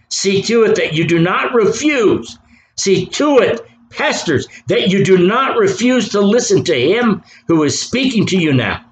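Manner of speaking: high, insistent pulpit warning; fervent preacher's admonition; urgent exhortation. Real, not acted. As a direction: press each command on the listeners.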